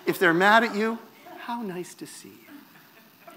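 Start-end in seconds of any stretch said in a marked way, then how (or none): 1.4-3.3 soft, slow, sugary mock-polite; fake politeness. Angry Canadian greeting someone, mock sweet politeness. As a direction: smile through the anger, slowly and quietly. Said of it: "Canadians are terminally polite".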